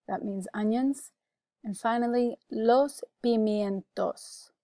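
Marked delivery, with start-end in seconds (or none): 2.5-4.5 "los pimientos" slowly, syllables clear; slow, deliberate "los pimientos"; careful pronunciation model. Shown as a demonstration. Teacher gives the last word on the list, slow careful model. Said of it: "You can repeat for pronunciation".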